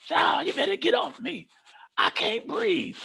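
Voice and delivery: high strained can't-breathe plea; strained panicked cry. Retold or imitated. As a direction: gasp it out pinned under him. Pinned down, struggling.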